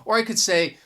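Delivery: ordinary talk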